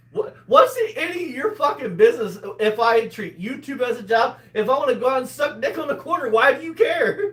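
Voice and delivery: high, rising indignant rant; indignant retort. Real, not acted. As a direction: challenge them, pitch climbing to the end. Heated retort about his livelihood.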